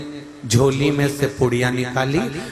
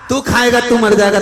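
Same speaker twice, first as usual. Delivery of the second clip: loud, high push; stern challenge; 8 semitones higher, 7 dB louder than usual. Acted. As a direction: dare him to eat it. Guru challenges weeping son. (गुरु बेटे को ललकारते हुए, ऊँची और ज़ोरदार आवाज़ में)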